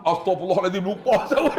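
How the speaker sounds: loud, rising exclamation dissolving into laughter; laughing mock dismay. Pilgrim catching himself before punching someone, laughing exclamation. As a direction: blurt it high and loud, cracking up.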